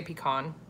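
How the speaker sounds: "pecan" pronounced pee-KAHN. Shown as a demonstration. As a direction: end "pecan" on a long open KAHN. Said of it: "Yeah, I say".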